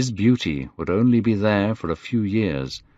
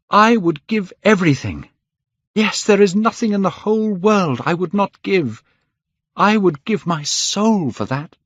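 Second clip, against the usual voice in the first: high, fervent outpouring; impassioned vow; 11 semitones higher, 4 dB louder than usual. A narrator's reading. Dorian wishing the picture would age instead, impassioned. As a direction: plead it with rising passion, pitched high. Dorian offers his soul.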